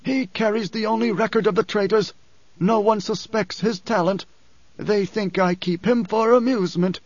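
high, sick man's voice with new force; frail but suddenly insistent; odd strength. A narrator's reading. Othame urges Paul to save the dwarf, with odd strength. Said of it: "an odd strength in his voice".

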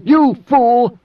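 high, loud outburst; angry snap. Acted. Moneo cuts off Idaho's accusation, angry snap. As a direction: snap at him, pitch leaping up. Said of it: "Mineo snapped".

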